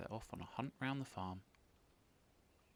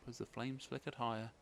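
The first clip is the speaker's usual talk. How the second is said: ordinary delivery, nothing marked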